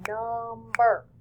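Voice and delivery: "number" slow, split into num and ber; snapped-out syllables. Shown as a demonstration. Teacher snapping out number for two syllables, drawn-out syllable by syllable. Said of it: "if I snap it out".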